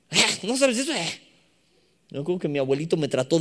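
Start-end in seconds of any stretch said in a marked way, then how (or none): 0.1-1.2 high, put-on disbelieving voice; mock scornful teacher. Teacher to pupil who never learned it, mock disbelief. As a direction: play the teacher appalled at ignorance. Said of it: "O maestros que".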